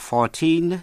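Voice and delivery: put-on fourteen with the weight on "teen"; stress on second syllable. Shown as a demonstration. Teacher showing listeners how fourteen differs from forty, second syllable stressed. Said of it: "nhấn vào vần nhì".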